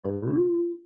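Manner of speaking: high howling aroo; dog howl. Acted. The dog Chicory calls out: high howl. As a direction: howl it like a hound.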